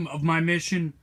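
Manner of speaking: ordinary talk, nothing marked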